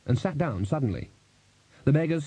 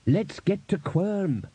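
higher, drawn-out urging; urgent; 8 semitones higher, slower than usual. A narrator's reading. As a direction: press them to leave. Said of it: "said Buddy urgently".

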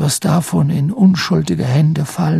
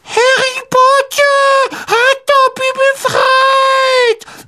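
very high, slow squeal; shrill elf voice, words stretched out in awe; schriller Hauself; 3 dB louder, much slower than usual. A narrator's reading. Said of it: "sagte der Elf schrill". (schrille, gedehnte Elfenstimme)

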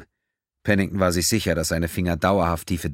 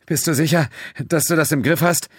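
nervous question; 10 semitones higher, 4 dB louder than usual. A narrator's reading. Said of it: "starrte nervös auf Quinn".

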